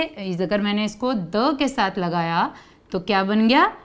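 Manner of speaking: usual speaking voice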